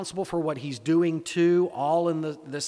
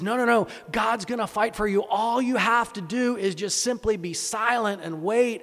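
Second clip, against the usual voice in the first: higher, louder insistent reassurance as Moses; rallying; 6 semitones higher, 4 dB louder than usual. Acted. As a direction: cut in and rally the frightened crowd. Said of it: "he rallies them".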